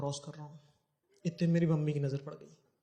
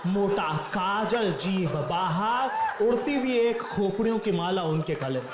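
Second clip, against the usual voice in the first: slow and louder epic narration; slow each image down for the reveal; narrating mother turning into Chandika, slow and louder; dramatic build-up; 7 semitones higher, 4 dB louder, slower than usual. Acted. Dramatic goddess transformation narration.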